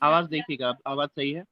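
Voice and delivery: soft and faint over the line; speaking quietly, far from the mic; barely audible. Real, not acted. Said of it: "थोड़ा और जोर से".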